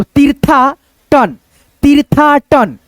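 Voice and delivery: very slow, drawn out; slow syllable reading. Shown as a demonstration. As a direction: sound out each part for the class. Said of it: "जैसे तीर्थ". (धीरे-धीरे उच्चारण)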